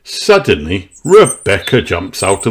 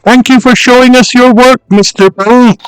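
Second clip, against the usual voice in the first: high, louder polite thanks; Mummy Pig thanking; 5 dB louder than usual. A narrator's reading. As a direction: pitch up and call out warmly. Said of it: "says Mummy Pig".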